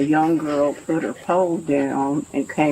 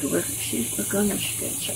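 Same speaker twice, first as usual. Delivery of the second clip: soft, breathy, higher warning; frightened whisper; 5 semitones higher, 7 dB quieter than usual. Retold or imitated. Scared girl warning the narrator about Marie, whispered. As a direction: hush it like a scared kid.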